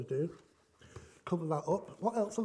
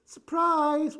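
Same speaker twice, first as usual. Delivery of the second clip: much higher and louder, bursting with joy; spring the good news on them; gleeful "Surprise" shouted high; excited surprise; 9 semitones higher, 9 dB louder than usual. Acted. Joyful proposal version of surprise.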